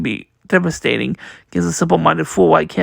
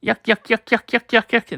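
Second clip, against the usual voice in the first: higher, staccato "yuk-yuk" chuckle; put-on yukking laugh; 4 semitones higher than usual. Luffy hit by laughter paint, mock yukking laugh. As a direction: chop out a comic cartoon laugh. Character's cackle spelt out.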